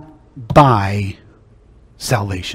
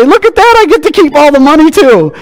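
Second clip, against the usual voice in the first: much higher, louder gleeful mock voice; 6 dB louder than usual. Preacher mocking healed Christians keeping money, high gleeful voice. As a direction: lampoon the smug believer. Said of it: "and then they say".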